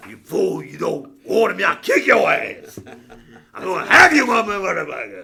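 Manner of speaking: loud, slurred, laughing threats; belligerent drunk. Acted. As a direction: bellow it like a drunk picking a fight. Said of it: "I didn't know you had it in you".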